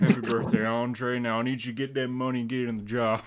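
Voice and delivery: low game outlaw boss voice; Dutch. Acted. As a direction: drop low and order the gang around. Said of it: "Do the Dutch voice".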